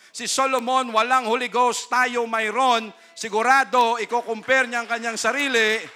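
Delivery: preacher at full force to congregation; full-force preaching. Acted. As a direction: preach it with fire.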